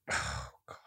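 breathy whisper. Real, not acted. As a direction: whisper it in pain. Eyes burning from icy hot.

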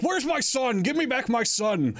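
high, frantic demand for his son; panicked detective voice. Retold or imitated. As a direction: beg for him back, voice up high. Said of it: "he's like".

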